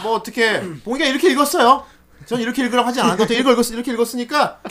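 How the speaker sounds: high-pitched rushed jabber; mock speed-reading. Host teasing the co-host about his reading, mock speed-reading. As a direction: tumble over the words in a hurry. Mimics co-host's speed-read message.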